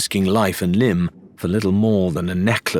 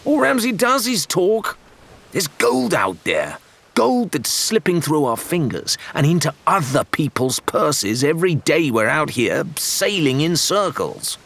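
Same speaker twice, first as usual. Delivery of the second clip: higher, heated outburst in a put-on sailor's voice; exploding; 8 semitones higher than usual. A narrator's reading. Rathbone bursting out at Shan over endless talk, angry. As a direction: blow up, fed up with talk. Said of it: "Rathbone exploded".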